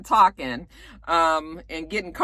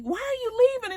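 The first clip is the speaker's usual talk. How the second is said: high whiny question; 14 semitones higher, 3 dB quieter than usual. Retold or imitated. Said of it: "she was just like".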